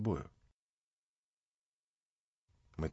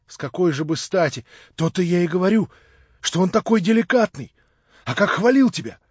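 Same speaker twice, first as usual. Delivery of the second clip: put-on young man's voice, higher and louder, gushing; eager young Alyosha; 7 semitones higher, 9 dB louder than usual. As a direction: Alyosha bubbling over with delight. Alyosha voiced by the reader, excited. (восторженный юноша, выше и громче)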